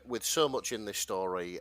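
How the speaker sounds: ordinary talk, nothing marked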